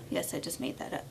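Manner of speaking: hushed, sheepish aside; quick whispered admission. Real, not acted. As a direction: murmur it like a confession. Unvoiced aside after coinage.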